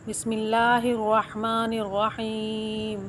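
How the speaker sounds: usual speaking voice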